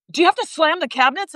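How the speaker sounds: high-pitched mock accusing question; imitated complaint. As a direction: mimic a nagging partner, voice lifted. Imitating ex's petty complaint.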